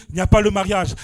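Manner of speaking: usual speaking voice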